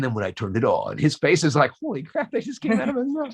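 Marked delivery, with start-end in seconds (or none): 1.8-3.4 mock astonishment. Ryan's stunned face, voiced.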